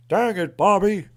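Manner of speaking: loud, high, slow exasperated cartoon dad; Hank Hill. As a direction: scold the boy in a hammed-up Texan voice. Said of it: "I got King of the Hill fans".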